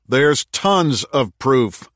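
slow, loud bark. Acted. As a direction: bark it out slowly. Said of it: "barked Jake".

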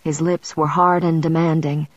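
plain reading voice

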